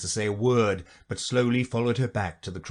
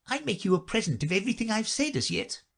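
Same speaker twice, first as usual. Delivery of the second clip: Duchess voice; 11 semitones higher, faster than usual. A narrator's reading.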